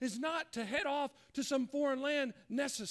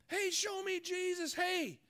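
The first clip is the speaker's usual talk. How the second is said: high, drawn-out mock plea; calling out; 6 semitones higher, slower than usual. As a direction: flag someone down, pitched up and slow. Acted seeker calling out.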